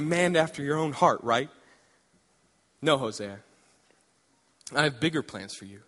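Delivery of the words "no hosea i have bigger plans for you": lower, softer, calm divine voice; voice of God. Acted. God answering eager Hosea, low and calm. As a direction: speak as God, low and steady.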